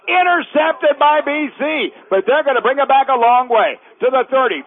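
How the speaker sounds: loud, high-pitched call that surges on the interception; excited play-by-play. Real, not acted. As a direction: call the turnover at full voice, stretching the big moments. Calls an interception live.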